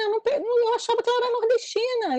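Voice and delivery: excited amazement. Real, not acted. Audience member marvelling at the northeastern character, excited. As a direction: gush in rushed, lifted amazement.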